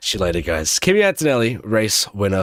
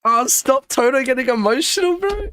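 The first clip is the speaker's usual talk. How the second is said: high touched cry; overwhelmed squeal; 12 semitones higher than usual. Real, not acted. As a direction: squeal at the emotional scene. Toto crying on screen.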